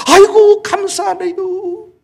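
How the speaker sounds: high drawn-out mock thanks; stretched high exclamation of thanks; mock grateful cry. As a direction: ham up a pleased old believer. Mock grateful reply in sermon.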